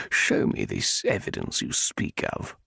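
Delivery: low, slow male voice; demanding. A narrator's reading. Viscount demanding proof from the heroine. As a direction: press her, low and slow. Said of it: "he demanded".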